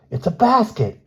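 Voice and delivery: high, loud amazed gasp. A narrator's reading. Bruno spotting the basket in the tree, high amazed gasp. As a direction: blurt it out in amazement, pitched high. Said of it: "gasped Bruno".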